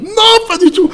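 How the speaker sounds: loud, very high cry of denial; starstruck protest. Retold or imitated. Fan assuring Brando he is the only idol, starstruck shout.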